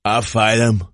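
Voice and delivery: imitated hammered tough-guy accent; mock a slurred fighter's accent; Hardy. Co-host joining the fight film impression, mock hammered accent.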